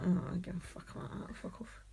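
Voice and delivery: quick, low-volume mock grumble; dismissive muttering. Imitating people fed up with bands, dismissive muttering. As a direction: mutter it fast, trailing into near silence.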